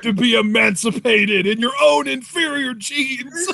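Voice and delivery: laughing mock-villain declaration, a bit high; villain line. Co-host playing a dictator, laughing through the line. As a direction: proclaim it like a grand movie baddie. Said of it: "that line delivery was pretty good".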